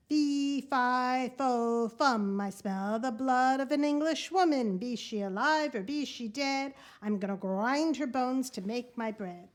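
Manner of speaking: loud, heavy giant chant, each word pounded out; booming giant. Acted. Giant sniffing out the hidden girl, booming chant. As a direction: boom it like a greedy giant sniffing the air.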